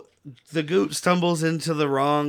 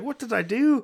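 mock anguish; 7 semitones higher than usual. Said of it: "and he's like".

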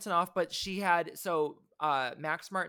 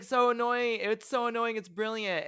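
put-on higher, louder hammy Swedish accent; 7 semitones higher, 5 dB louder than usual. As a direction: ham up a Swedish producer's accent. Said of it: "he was like".

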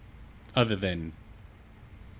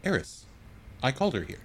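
reassuring; 7 semitones higher than usual. Acted. GM's character calming armed Eris.

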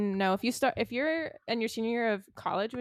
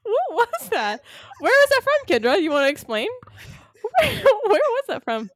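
high, loud, laughing astonishment; laughing disbelief; 12 semitones higher, 11 dB louder than usual. Real, not acted. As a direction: squeal it out in amused shock.

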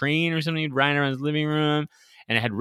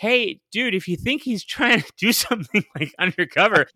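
higher, exasperated scolding; 6 semitones higher than usual. Retold or imitated. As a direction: talk down to a clueless dude. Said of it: "And it's just like".

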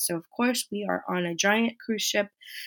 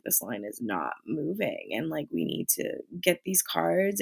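low, soft muttered complaint under the breath; muttering to herself; 3 semitones lower, 7 dB quieter than usual. Retold or imitated. Waiting in a stalled line, grumbling quietly to herself. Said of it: "and I'm just like".

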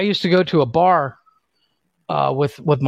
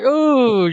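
disgusted high ooh; 9 semitones higher than usual. Retold or imitated. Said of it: "I was like".

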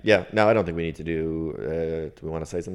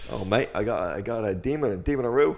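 put-on higher-pitched frazzled Australian accent; 4 semitones higher than usual. Possessed friend confiding in his mate, frazzled Australian. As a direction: put on an Australian, climbing at the end. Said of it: "the demon infested frazzled Australian".

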